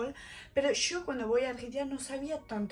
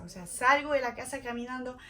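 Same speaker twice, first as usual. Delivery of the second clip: normal talking voice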